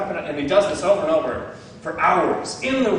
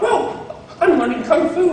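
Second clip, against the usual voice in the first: much higher, louder, laughing wonder; amazed kid; 12 semitones higher, 5 dB louder than usual. Retold or imitated. Student realizing he learned kung fu, thrilled and high. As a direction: gasp it out like a kid discovering his power. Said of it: "he finds out".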